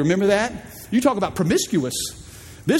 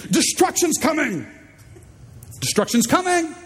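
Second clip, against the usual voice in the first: mock shout; 10 semitones higher than usual. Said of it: "he shouts to the community".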